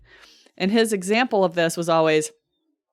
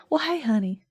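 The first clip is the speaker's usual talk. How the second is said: put-on Savannah accent; 5 semitones higher than usual. Friend imagining a Savannah lady's greeting, lightly put-on Southern accent. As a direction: greet them the way a Savannah lady would. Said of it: "a Savannah version of that".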